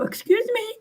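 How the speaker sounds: high, sharp indignant question; indignant excuse me. Retold or imitated. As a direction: shoot it back in disbelief. Indignant retort quoted.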